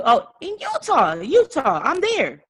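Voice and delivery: excited. Real, not acted. Pumped up declaring goal on call.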